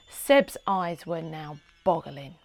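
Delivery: ordinary delivery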